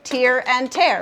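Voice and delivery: slow contrast, first tear rhymes with ear, second tear with air; two pronunciations. Shown as a demonstration. Teacher shows students heteronyms, two pronunciations of tear. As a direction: TEAR as teer, then TEAR as tair, slowly. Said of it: "pronounced two different ways".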